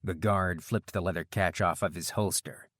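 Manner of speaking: ordinary delivery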